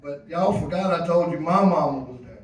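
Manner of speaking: usual speaking voice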